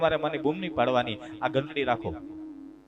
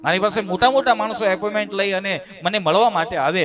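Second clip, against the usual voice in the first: raised, louder pompous brag; indignant boast; 6 semitones higher, 6 dB louder than usual. Retold or imitated. Son bragging about his status, indignant. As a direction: puff up with wounded pride.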